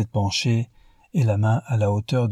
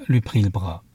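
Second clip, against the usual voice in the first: ordinary delivery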